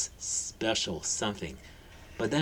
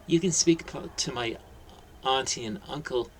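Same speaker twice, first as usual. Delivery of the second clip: put-on polite child; 4 semitones higher than usual. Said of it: "Harry retorted politely".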